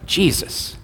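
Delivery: usual speaking voice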